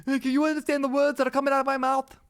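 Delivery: high-pitched hammed-up quote; squeaky mock-indignant delivery; mock exasperation. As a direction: pitch it up high and hold it there. Quotes a line in mock disbelief.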